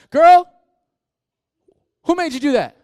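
loud, high incredulous call-out; mock confrontation. Pastor loudly challenging a teen who blames others. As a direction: call her out, big and disbelieving.

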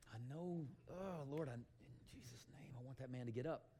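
soft, halting prayer under the breath; timid muttered prayer. Acted. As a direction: pray quietly and haltingly, half to yourself. Acting timid Christians' inward prayer.

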